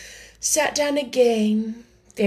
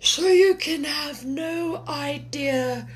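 mournful Mock Turtle; 6 semitones higher than usual. A narrator's reading.

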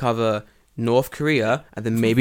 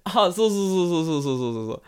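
higher put-on nodding "so, so"; imitating Tanaka; 6 semitones higher than usual. Teacher imitates Tanaka sensei's eager agreeing. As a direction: nod along like a polite Japanese colleague. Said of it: "He's like".

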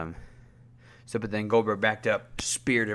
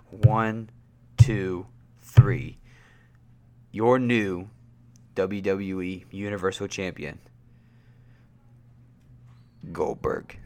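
lightly voiced match count and announcement; mock ring announcer; 4 dB louder than usual. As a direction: count the pinfall, then announce the champion. Mimics count and winner announcement.

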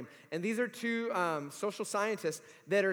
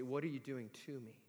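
muttered complaint; 6 semitones lower, 12 dB quieter than usual. Retold or imitated. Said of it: "we say".

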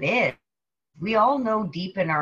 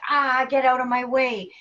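irritated; 6 semitones higher, 4 dB louder than usual. Retold or imitated. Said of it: "Everybody else is like".

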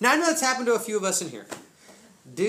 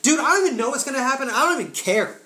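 loud, high, carefree declaration; high-energy imitation, throwing caution away; retold fired-up faith; 7 semitones higher, 6 dB louder than usual. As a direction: act out a friend bursting with faith. Friend's faith rising, acted out.

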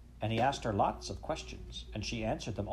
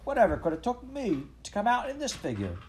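high-pitched Cockney dismay; acted scandalised street seller; shocked market woman; 12 semitones higher, 4 dB louder than usual. A narrator's reading. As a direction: wail in comic embarrassment. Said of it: "said a marrow-selling woman".

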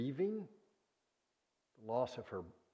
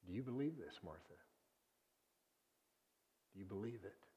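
gentle question; 4 semitones lower, 12 dB quieter, faster than usual. Pretend. Jesus gently questions grieving Martha.

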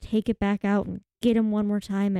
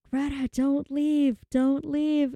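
imitated plea; 5 semitones higher, slower than usual. Acting the villain pleading with Greta.

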